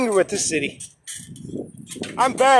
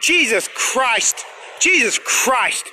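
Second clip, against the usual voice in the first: exasperated; 4 dB quieter, much slower than usual. Real, not acted. Worn out, muttering in exasperation.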